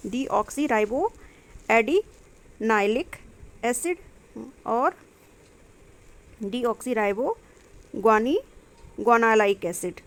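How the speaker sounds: slow, syllable by syllable; spelling out terms. Shown as a demonstration. Teacher sounding out long chemical names for the class, slow. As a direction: carefully sound out each long name.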